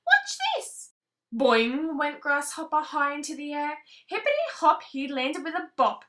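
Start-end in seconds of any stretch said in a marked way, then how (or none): 0.1-0.9 very high squeaky bug voice; tiny high grasshopper. Acted. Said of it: "said Grasshopper". 4.1-4.8 much higher hoppy words; high bouncy. A narrator's reading. Bouncy sound words in story.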